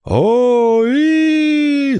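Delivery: very high wavering wail; ghostly howl. A narrator's reading. Boy pretending to be ghost at pirate, high howl. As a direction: howl like a make-believe phantom. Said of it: "завыл Брэндон".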